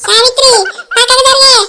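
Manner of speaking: loud, high, laughing yell to move aside; laughing shout. Acted. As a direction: yell a warning through laughter. Warning as rocket falls. (నవ్వుతూ గట్టిగా పక్కకు జరగమని అరుపు)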